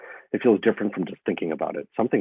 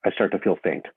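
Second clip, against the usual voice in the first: ordinary talk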